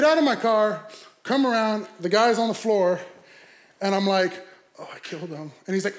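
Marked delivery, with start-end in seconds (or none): 4.8-5.5 low, quiet mock dread; hushed realization. Driver seeing the man he hit on the floor, hushed. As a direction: say it small and soft, to yourself.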